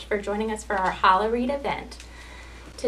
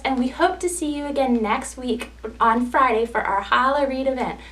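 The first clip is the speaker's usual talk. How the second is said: ordinary delivery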